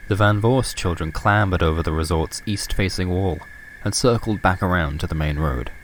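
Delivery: ordinary delivery, nothing marked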